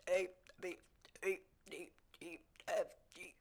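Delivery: alphabet letters burped out; burping the words. Shown as a demonstration. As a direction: burp each letter of the alphabet. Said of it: "I can burp the alphabet".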